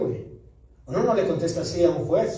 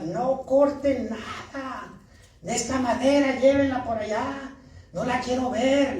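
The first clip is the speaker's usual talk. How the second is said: higher, slow mock yell of the manager; imitating the angry manager, not really yelling; retold angry shout; 6 semitones higher, slower than usual. Preacher retelling how the manager yelled, mock angry. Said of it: "y gritando".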